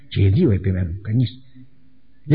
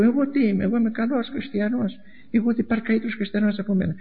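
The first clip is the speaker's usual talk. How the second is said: self-satisfied boast; 9 semitones higher than usual. Acted. Preacher mimicking people who think themselves perfect Christians, high boastful voice. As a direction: boast in a high put-on voice, full of yourself.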